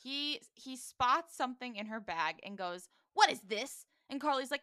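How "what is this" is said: acted confrontation. Acted confrontation over the bag.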